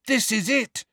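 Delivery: high-pitched exclamation; excited discovery. A narrator's reading. Harry on opening the right door, excited. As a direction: cry it high, sudden recognition.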